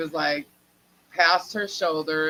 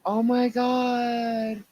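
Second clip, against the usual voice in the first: stunned, slow; 7 semitones higher, much slower than usual. Retold or imitated. Seeing bald sister.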